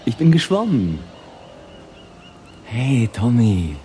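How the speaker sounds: loud, ringing call from a dream figure; nightmare taunting. Acted. Drowned Dickie appearing to Tom in a nightmare, taunting shout. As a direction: call out triumphantly to haunt him.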